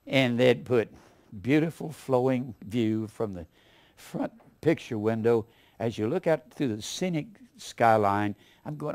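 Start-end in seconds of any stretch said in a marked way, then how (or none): 1.4-8.4 lower, softer, gushing sales-ad voice; mock flowery ad copy. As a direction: read it like overblown real estate copy. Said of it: "pretty words".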